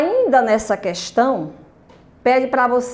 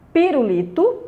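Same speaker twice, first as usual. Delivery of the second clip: teacher's careful "pirulito", stressed on li; 3 semitones higher than usual. Shown as a demonstration. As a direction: model "pirulito", leaning hard on li. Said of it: "o li".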